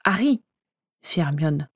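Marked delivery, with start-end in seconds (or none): 0.0-0.4 a touch of reproach, a bit higher; reproachful. Acted. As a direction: gently scold him by name. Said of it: "d'un air de reproche".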